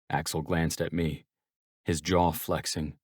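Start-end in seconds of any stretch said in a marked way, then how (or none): none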